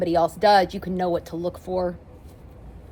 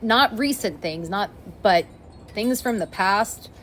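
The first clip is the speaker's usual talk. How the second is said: ordinary talk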